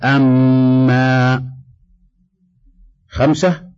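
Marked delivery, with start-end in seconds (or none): 0.0-1.4 the doubled م in أما held long as a level hum; clear ghunna. Shown as a demonstration. Teacher giving an example of doubled mim with fatha, أما with م held nasally. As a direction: hum slowly through the مّ of أما before the vowel. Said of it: "غنة ظاهرة".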